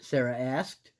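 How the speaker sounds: usual speaking voice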